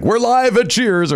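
news reporter voice. Retold or imitated. Local news reporter after finale.

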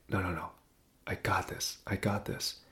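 very soft, under-the-breath self-talk; steady yourself in a hushed voice; quiet self-reassurance. Retold or imitated. Talking himself steady on a wobbly ladder, hushed.

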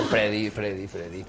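imitating a caller. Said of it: "me dice".

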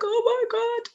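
high, overwhelmed tearful gasp; tearful cry. Retold or imitated. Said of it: "I was just crying my eyes out".

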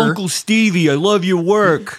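high, slow starstruck fan voice; gushing fan. Pretend.

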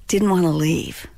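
usual speaking voice

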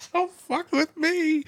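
high, slow mocking shriek; mock high scream. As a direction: squeal it out high and drawn, mocking.

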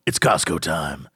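low cool-guy hype call; hyped boss announcement. Pretend. Boss announcing Costco trip to team, low and cool. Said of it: "gather the entire team ... be like".

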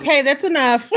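loud, high, laughing cutoff; playful imitated client; laughing mock client. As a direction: shout it like an impatient client, laughing. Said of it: "And she's like".

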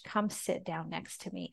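soft, hushed, tender call; barely above a murmur, patting the couch; gentle invitation. Retold or imitated. God inviting her to sit beside him, tender and hushed. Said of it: "this little pat, pat on the couch".

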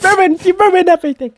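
high, hammed-up whine; over-the-top squeaky blame; mock accusation. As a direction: wail it playfully at your friend. Mock squabble with friend.

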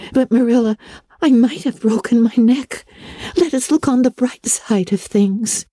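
high, shaky reassurance; brave hurt Anne. A narrator's reading. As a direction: comfort Marilla despite the pain.

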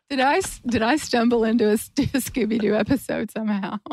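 giggling through the words; laughing. Real, not acted. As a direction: crack up mid-question. Host cracks up at her own story.

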